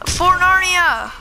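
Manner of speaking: slow, drawn-out loud war cry; mock battle cry. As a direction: charge in like a hero.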